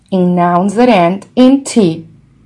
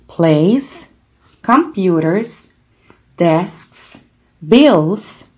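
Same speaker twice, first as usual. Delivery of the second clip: usual speaking voice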